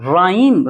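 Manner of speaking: राइम called out loud, no h after r; teacher presenting राइम; model word rhyme. Shown as a demonstration. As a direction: announce राइम like rime. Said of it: "राइम का मतलब होता है".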